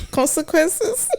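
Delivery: CONSEQUENCES pitched very high, rising at the end; mock-ominous echo. Co-host recalls chores at home, CONSEQUENCES in high mock alarm. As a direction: squeal CONSEQUENCES as a high question.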